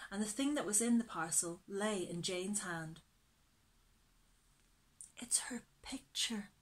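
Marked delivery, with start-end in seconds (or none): 5.2-6.5 hushed, awed whisper; a girl whispering over a precious picture. Acted. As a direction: breathe it out in wonder. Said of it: "she said in a whisper".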